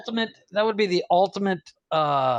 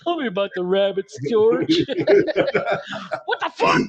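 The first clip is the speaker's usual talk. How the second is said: Lennie impression; 3 semitones higher than usual. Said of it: "I don't know, Yogi".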